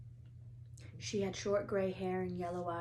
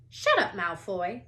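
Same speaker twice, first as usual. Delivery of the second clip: louder sharp retort; snapped; 9 dB louder than usual. A narrator's reading. Parvati snaps at Malfoy, loud. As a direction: snap at him. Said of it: "snapped Parvati Patil".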